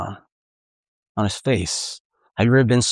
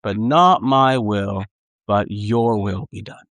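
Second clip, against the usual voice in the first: slow prayer; 3 semitones lower, slower than usual. Acted. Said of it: "But he says".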